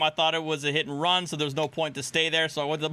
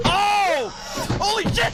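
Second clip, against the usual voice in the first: high, loud shocked cry; 14 semitones higher, 4 dB louder, slower than usual. Real, not acted. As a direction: gasp it out in disbelief. Reacting to the crash.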